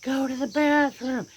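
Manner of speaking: owner's exasperated command; firm command. Retold or imitated. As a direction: bark the order at a stalling dog. Owner ordering dog outside.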